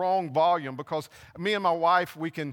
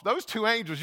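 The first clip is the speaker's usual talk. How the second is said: ordinary delivery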